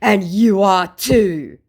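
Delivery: mock a dim scammer's retort; stupid scammer. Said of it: "said my stupid scammer".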